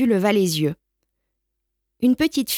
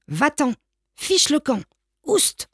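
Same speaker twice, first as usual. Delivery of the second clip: rising shouts, climbing much higher each time; people shouting him away; 6 semitones higher than usual. Acted. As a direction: chase the dog off with sharp high cries. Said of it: "On lui criait". (des passants qui chassent le chien en criant de plus en plus aigu)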